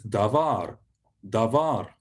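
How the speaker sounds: "davar" said da-VAR; stressed last syllable. Shown as a demonstration. Teacher modelling Hebrew for word, "davar" stressed at the end. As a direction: repeat "davar" with weight on the final syllable. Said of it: "normally on the last syllable".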